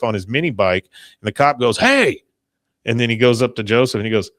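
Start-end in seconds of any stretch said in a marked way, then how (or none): 1.8-2.2 loud, high mock shout; calling out. Cop to Joseph on his mini bike, calling out. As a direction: holler it sharply to stop someone.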